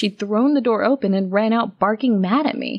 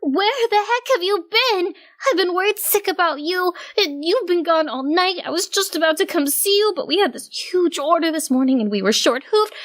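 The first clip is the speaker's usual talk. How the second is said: much higher, frantic worried scolding; Daisy voice; 9 semitones higher than usual. A narrator's reading. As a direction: fire it off in a worried rush.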